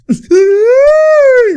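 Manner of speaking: loud, very high drawn-out shriek; high-pitched squeal. Real, not acted. Reacting to Boban's play, a high excited shriek.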